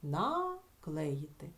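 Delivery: Наклеїти drawn out very slowly; teacher isolating the word slowly; very slow demo word. Shown as a demonstration. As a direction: stretch Наклеїти out syllable by syllable. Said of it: "Чи можемо ми ось тут вставити якесь слово?".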